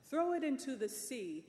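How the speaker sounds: lady commands the wheat thrown away; angry command. Acted. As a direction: bark the order imperiously. Lady orders crew.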